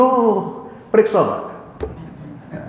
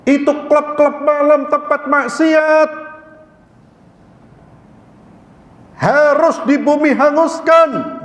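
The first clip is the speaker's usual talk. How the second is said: zealot's outrage; 7 semitones higher, slower than usual. Retold or imitated. Voicing an overzealous vigilante.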